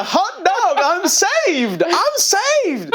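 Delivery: very high, ecstatic cry of Hot dog, I'm saved; teen cries out his joy in English; joyful high shout. Retold or imitated. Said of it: "il a".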